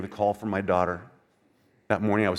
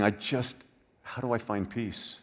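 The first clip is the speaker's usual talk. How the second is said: despairing hush; 8 dB quieter than usual. Pretend. Voicing hurting listener.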